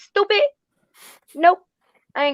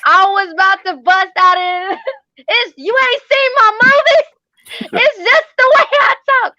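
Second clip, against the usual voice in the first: hammed-up outburst; 9 semitones higher, 4 dB louder than usual. Acting out a country-accent outburst, laughing.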